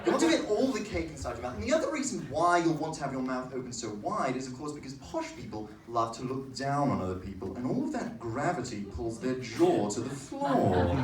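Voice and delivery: posh English with jaw hanging low; wide-mouthed posh English lecture; posh English accent. Shown as a demonstration. Comic explaining why posh people drop their jaws, in posh English. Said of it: "a posh English accent".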